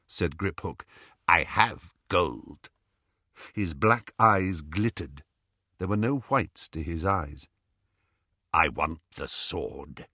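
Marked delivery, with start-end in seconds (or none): 1.3-2.7 slow goblin voice; dismissive goblin. Acted. 8.5-10.1 low, slow goblin demand; name the price slowly and low. Acted. Goblin names his price.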